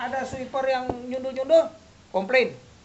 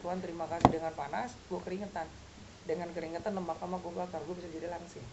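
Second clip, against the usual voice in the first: mock prayer; 5 semitones lower, 8 dB quieter than usual.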